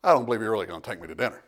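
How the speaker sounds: low, quick, muttered aside; mock disbelief. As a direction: play the sceptic under your breath, amused. Translates guest's disbelief, laughs after.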